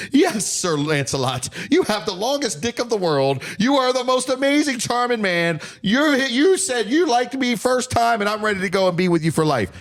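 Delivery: high, mocking, star-struck lady's voice; mock swooning woman. Mocking men's idea of women swooning at their courtship, high mock gushing voice. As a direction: ham up a woman gushing over her knight. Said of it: "them in the history of time and say".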